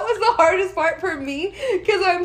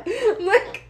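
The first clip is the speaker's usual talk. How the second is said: ordinary talk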